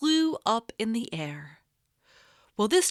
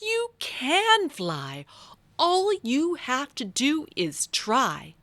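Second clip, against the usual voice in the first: mild encouraging exclaim; 6 semitones higher, slower than usual. Acted. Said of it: "exclaimed very loudly".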